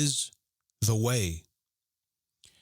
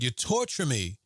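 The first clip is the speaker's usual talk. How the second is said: ordinary talk, nothing marked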